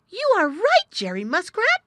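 high, loud, amazed outburst; crying out. A narrator's reading. Little Joe suddenly sees Jerry was right, crying out. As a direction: exclaim it in astonishment. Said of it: "he cried".

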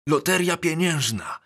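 high-pitched burst of excitement; excited. A narrator's reading. Schoolboy telling his mates about a money lottery, excited. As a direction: blurt it out, thrilled. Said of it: "emocjonował się".

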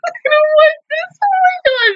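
very high crying voice; tearful dread. Real, not acted. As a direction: squeak it out through sobs. Dreads what comes, breaks down.

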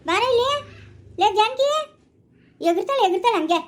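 high, loud scolding summons; calling out. Acted. Ordering Janaki to come here, loud and high. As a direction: holler for someone to come here.

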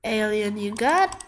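ordinary delivery

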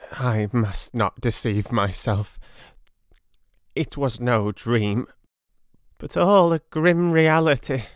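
high, breathless, slow broken voice of a feverish madman; weak dying patient. Acted. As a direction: barely push the words out, pained and confused. Patient grasps the truth.

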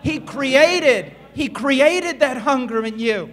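loud, high preaching build; full-force revival preaching. Acted. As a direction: preach it with rising fire.

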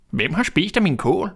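lightly voiced dwarf wondering; puzzled dwarf. A narrator's reading. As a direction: ask it like a bewildered small dwarf. Fourth dwarf questions.

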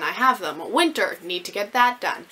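ordinary talk

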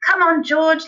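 high, loud call to action; rally the boy briskly; eager urging. A narrator's reading. Father leaping up to get George going, excitedly. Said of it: "leaping up from his chair".

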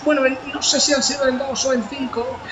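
normal talking voice

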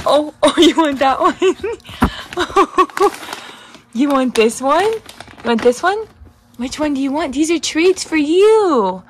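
high-pitched cooing questions; coaxing pet talk. Real, not acted. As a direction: tease your pet with a choice of treats. Offers pet treats.

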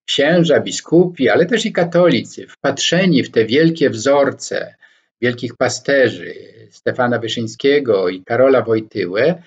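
ordinary talk

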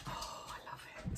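whispered with delight; adoring whisper. Real, not acted. Knitter cooing over soft yarn, whispered. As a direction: breathe it out lovingly.

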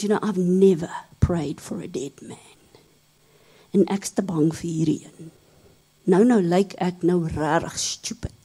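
hushed, breathy whisper of a scared thought; whispered inner thought. Retold or imitated. Scared pastor thinking beside the body, whispered. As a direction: whisper it to yourself, afraid to be heard.

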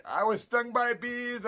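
put-on high, slow, pitiful little-kid voice; a hurt nine-year-old telling mom what happened, slowly; stung kid's plea. Stung boy tells his mother at the door, high and pitiful. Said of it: "And I'm like".

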